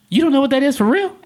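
loud, high mock disbelief; incredulous lady. Lady astonished the corporate guy is clueless, incredulous. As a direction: question it in amazement, pitch way up. Lady amazed at his ignorance.

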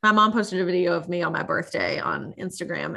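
normal talking voice

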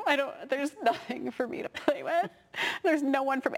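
high-pitched imitation of a whiny child; six-year-old complaining. Six-year-old at a sibling's play date, whiny. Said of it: "The six-year-old was there going".

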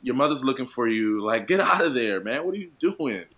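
animated, half-joking urgent scolding; comically exasperated plea. Acted. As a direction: call her home like an exasperated relative. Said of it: "like, how you're saying it".

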